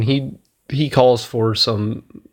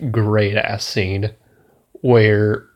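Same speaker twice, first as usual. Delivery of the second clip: normal talking voice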